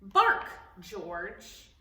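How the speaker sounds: a touch of a stern mother; mother's voice. Acted. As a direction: tell the puppy to bark.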